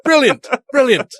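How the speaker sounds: posh South African voice; South African accent. Acted. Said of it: "That was South African".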